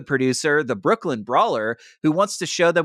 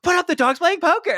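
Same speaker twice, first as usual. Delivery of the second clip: much higher mock mom giving orders; mock bossy mom. Mom ordering her daughter to hang the pictures, high mock bossiness. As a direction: bark it like a fussing mom. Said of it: "It's like".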